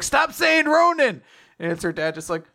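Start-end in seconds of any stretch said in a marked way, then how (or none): 0.0-1.2 high, loud, drawn-out mock protest; hammed-up exasperated yell; freaked-out shout. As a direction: shout it slowly, pitched way up. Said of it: "she freaks out".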